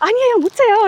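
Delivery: high, loud, re-enacted gift reaction; retold flustered surprise. Refusing the cafe lady's gift, retold flustered surprise.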